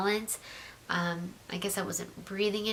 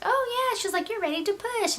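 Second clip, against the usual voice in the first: much higher singsong nurse voice; mock upbeat nurse; 14 semitones higher than usual. Nurse telling the mother she is ready to push, high mock cheer. Nurse checks and announces pushing.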